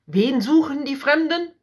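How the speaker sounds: the king jolts in alarm; frightened king crying out; startled cry. A narrator's reading. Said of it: "rief er erschrocken".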